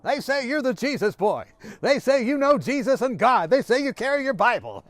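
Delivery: loud, high-pitched imitated yell. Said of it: "he began yelling at me".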